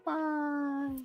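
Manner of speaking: put-on high, soft little kid's bye; tiny child goodbye. A kid waves goodbye to mom, high tiny voice. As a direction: peep it like a small child waving off.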